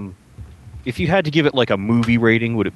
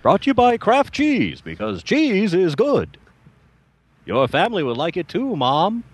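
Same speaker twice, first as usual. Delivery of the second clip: put-on high, bright old-time radio announcer; 1930s radio host; 10 semitones higher than usual. Shown as a demonstration.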